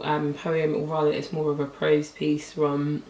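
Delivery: ordinary talk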